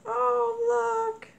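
high, loud gasp of delight; delighted squeal. Real, not acted. Opening happy mail from a friend, high delighted squeal. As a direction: squeal at the cute find.

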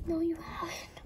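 soft unvoiced whisper. Searching for the troublemaker, whispering an aside. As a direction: breathe it as a secret.

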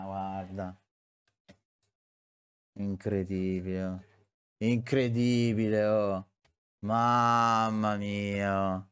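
low, deflated muttering; dejected disbelief. Real, not acted. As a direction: sink into quiet despair. Dejected after conceded goal.

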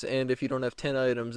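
ordinary talk

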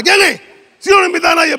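high, loud, put-upon host; annoyed shout. Acted. Host interrupted at dinner by a knock, annoyed shout. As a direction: yell at the unwanted visitor for laughs.

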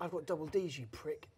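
hushed recap of the heckle; quiet mock heckler.

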